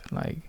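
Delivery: long breathy sigh instead of words; weary sigh. Retold or imitated. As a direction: sigh out your reluctance. Exasperated inner reaction in head.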